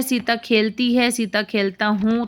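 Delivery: usual speaking voice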